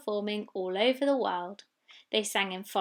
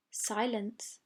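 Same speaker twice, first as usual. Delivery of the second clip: plain reading voice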